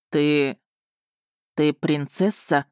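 halting, slow disbelief; stunned, slowly getting it out; forced out. A narrator's reading. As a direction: squeeze the words out after a pause. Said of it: "выдавил он".

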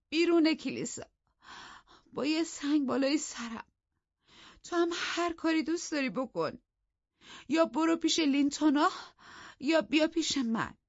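high, loud bitter contempt; angry. Acted. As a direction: spit scorn at your husband. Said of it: "با خشم گفت".

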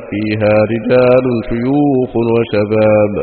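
loud, slow, measured recitation with long drawn vowels; hadith recitation. A narrator's reading. (تلاوة الحديث بصوت مرتفع ممدود ومتأنٍّ)

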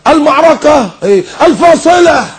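high, bombastic headline proclamation; mock headline announcer. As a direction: blare it slowly like a grand headline. Mock reading of newspaper headlines. (بنبرة مانشيت رنانة ساخرة)

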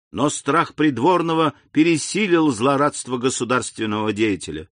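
ordinary delivery, nothing marked

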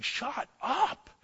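high-pitched imitation of an annoyed crowd; exaggerated shushing outburst; mock crowd rebuke. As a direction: silence someone with a high cry. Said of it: "they're saying".

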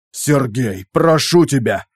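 urgent, strained appeal; tense begging, rising on the last words; hissed plea. A narrator's reading. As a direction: hiss a plea at a companion. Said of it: "зашипел безопасник".

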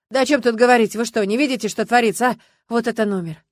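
agitated. Acted. Shaken witness getting worked up.

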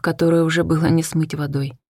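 usual speaking voice

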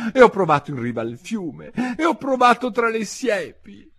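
high-pitched aggrieved bird; exasperated pigeon. A narrator's reading. Pigeon listing its failed attempts.

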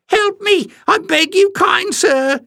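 very high, pitiful, piping plea; quavering beggar. Acted. Small stranger begging the newcomer: a very high quavering plea. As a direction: whimper it up high like a starving wretch. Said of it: "he quavered".

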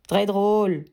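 lightly growled comeback; a touch of a dry grumble; mild growl. Acted. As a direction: Ron grumbling back at Harry's teasing. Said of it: "grogna Ron".

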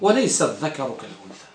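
a sigh of regret over a daughter; sad and regretful. Shown as a demonstration. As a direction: recite it with the mother's grief and regret. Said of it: "قالتها بحزن وحسرة".

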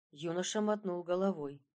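ordinary delivery, nothing marked

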